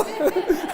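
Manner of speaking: short high burst of laughter; quick amused chuckle. Real, not acted. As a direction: laugh at your own joke. Laughs at his own joke.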